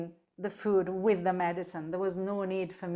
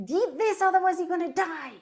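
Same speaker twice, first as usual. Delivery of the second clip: much higher, mock stern command; bossy owner; 10 semitones higher, 3 dB louder than usual. Owner forcing food on a sick pet, mock bossy. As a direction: play her old pushy self. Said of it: "I would say".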